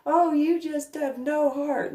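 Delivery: high, slow, whiny mock voice; mocking critic. Mimicking a critic who calls her heartless, high mock whine. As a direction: sneer it like a smug accuser.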